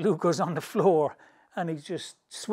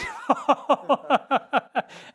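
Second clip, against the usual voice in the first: high laughter; laughing; 10 semitones higher, 6 dB louder than usual. Real, not acted. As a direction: breaks up laughing. Laughing after the punchline.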